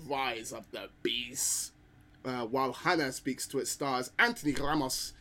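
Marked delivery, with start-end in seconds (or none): 0.1-1.7 hushed dramatic title; whisper. Pretend. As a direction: breathe the title out slowly.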